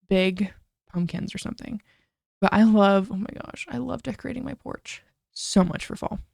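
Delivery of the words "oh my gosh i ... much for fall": breathy gushing. Real, not acted. Gushing excitedly about fall porch.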